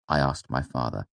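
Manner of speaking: usual speaking voice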